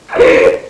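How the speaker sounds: high, bright burst of laughter. Real, not acted. Opening laugh, excited.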